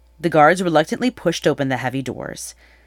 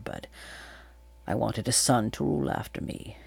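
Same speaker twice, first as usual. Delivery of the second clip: low, remorseful; 3 semitones lower than usual. A narrator's reading. King confesses to Atalanta that he abandoned his baby daughter, heavy remorse. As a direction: confess it heavily, fading almost to a whisper. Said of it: "the king spoke in a low voice, heavy with remorse".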